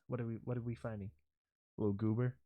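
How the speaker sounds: ordinary delivery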